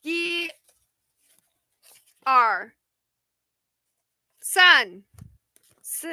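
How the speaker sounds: counting to ten in Mandarin, one at a time. Shown as a demonstration. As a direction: count slowly in Mandarin, with pauses. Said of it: "There you go".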